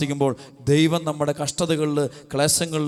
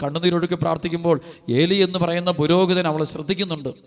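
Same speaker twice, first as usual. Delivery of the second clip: ordinary talk, nothing marked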